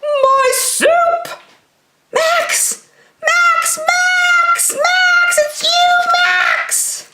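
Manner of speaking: very high, sung calls of Max's name; overjoyed at finding Max; gleeful cartoon chant; joyful singsong. Max returns, joyful reunion.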